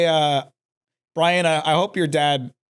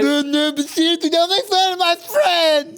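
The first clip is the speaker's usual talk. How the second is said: mocking nerd voice; 9 semitones higher than usual. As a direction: whine it like a nerd. Mocking nerdy voice at friend.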